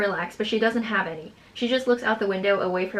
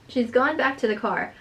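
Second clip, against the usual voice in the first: ordinary talk, nothing marked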